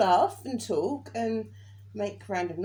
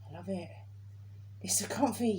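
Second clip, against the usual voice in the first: whispered; 2 semitones lower, 7 dB quieter than usual. Real, not acted. Blissful remark about her bed.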